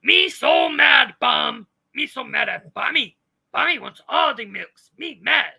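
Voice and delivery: loud, hammed-up angry brute voice; mock yelling Texas girl. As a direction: yell it like a tantruming monster. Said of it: "That's how I think of her".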